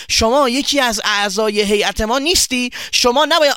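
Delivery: high, loud, mock-polite accusation; loud confrontation. Boy confronts his friend in the shop, loudly indignant. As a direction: call him out loudly, rising to outrage. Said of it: "با صدای بلند گفت".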